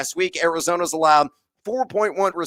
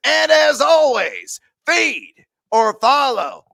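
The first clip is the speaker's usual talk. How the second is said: hyped sign-off; 7 semitones higher, 5 dB louder, slower than usual. Real, not acted. Signature sign-off catchphrase.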